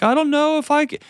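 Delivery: a hint of a husband about to cry; husband choking up over the grief, mock sob; imitating sobbing. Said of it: "he starts sobbing and he's like".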